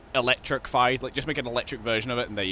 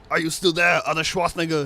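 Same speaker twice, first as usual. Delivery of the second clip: louder, higher put-on Arnold; 5 semitones higher, 6 dB louder than usual. Arnold returns to the call, loud put-on voice. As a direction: boom it like Arnold. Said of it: "he just comes back".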